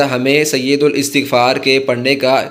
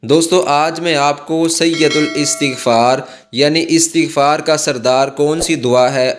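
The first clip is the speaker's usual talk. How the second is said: usual speaking voice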